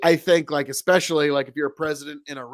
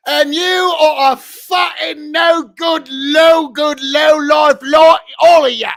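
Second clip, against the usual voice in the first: loud, high mock House of Commons tirade; hammed-up shouted parliamentary insult; rowdy British parliamentarian; 9 semitones higher, 9 dB louder, slower than usual. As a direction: heckle like a British MP across the chamber. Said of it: "like, Parliament on, in the U K, it's like".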